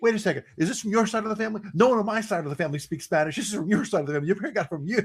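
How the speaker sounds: very high, fast accusing squabble; hammed-up bickering parent; arguing parent. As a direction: blame the spouse in a mock row. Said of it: "arguing over the Spanish-speaking baby".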